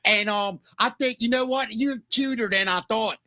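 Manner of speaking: high, mock Baltimore woman's voice; Baltimore accent. Host as Baltimore woman flirting with Mickey, high Baltimore accent. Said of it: "worse than the Baltimore accent".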